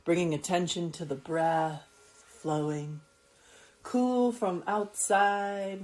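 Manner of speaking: slow, calm yoga nidra voice with long pauses; soothing, unhurried guide; guided meditation. Acted. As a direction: let each word settle, drawn out and gentle. Guiding attention to breath.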